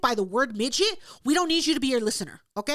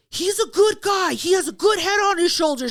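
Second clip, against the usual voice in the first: hearty shout; 6 semitones higher, 5 dB louder than usual. Retold or imitated. Quoting Ben's loud praise of the kid, higher and booming. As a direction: boom it out like a proud uncle. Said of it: "he was like".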